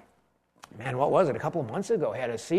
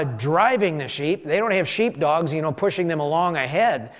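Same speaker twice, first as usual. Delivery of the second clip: ordinary talk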